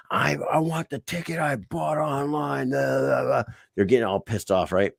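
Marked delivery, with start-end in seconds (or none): 0.1-3.4 whiny high customer voice, mocked; a petulant customer; mock angry customer. Angry customers quoted at window.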